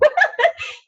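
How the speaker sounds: high sheepish laugh; burst of laughter. Real, not acted. Admitting she skipped her reading, laughing. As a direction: laugh it off, embarrassed.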